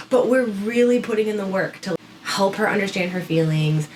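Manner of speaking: ordinary talk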